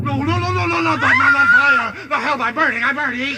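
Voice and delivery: shrill high cries of no; panicked. Acted. Scarecrow on fire, screaming high and loud. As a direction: scream as the flames catch.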